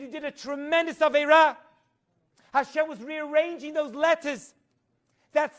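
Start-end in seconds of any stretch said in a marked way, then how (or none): 0.0-1.6 loud, high scolding from an angel; angel's rebuke. Retold or imitated. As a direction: scold him from above. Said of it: "and said".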